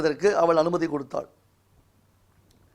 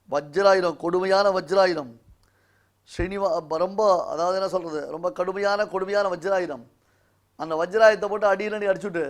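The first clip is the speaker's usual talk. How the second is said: ordinary talk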